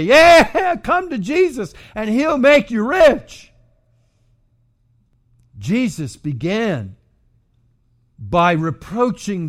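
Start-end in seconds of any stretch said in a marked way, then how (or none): 0.0-3.5 high, loud mock salesman pitch with a laugh; mocking modern evangelist. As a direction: lampoon a glib preacher. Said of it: "you hear them say".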